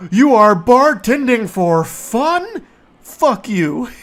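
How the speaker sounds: high, loud, slow disbelieving whine; incredulous mocking. Mocking the coworker's claim of bartending for fun, loud slow sneer. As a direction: drag it out in mock outrage.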